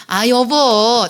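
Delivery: long, drawn-out coaxing call to a spouse; soothing demo. Shown as a demonstration. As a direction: model a tender call, loud and slow. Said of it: "자, 한번 연습해 볼까요?". (배우자를 달래듯 길게 부르며)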